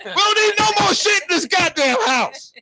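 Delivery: high, hammed-up yelling at the delivery man; mock yell. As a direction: rant at the UPS driver half laughing. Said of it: "I start yelling at him".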